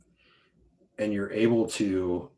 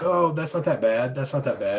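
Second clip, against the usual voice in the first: higher-pitched breezy self-reassurance, easing off; mock optimism, pitched up at the start; over-optimistic; 5 semitones higher than usual. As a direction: imitate someone brushing off bad news. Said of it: "we're, like, over optimistic".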